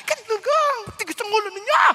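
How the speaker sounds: high, loud angry tirade; hammed-up screaming host; shouting scold. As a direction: yell a furious scolding at the household. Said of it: "Nagsisigaw yung hali".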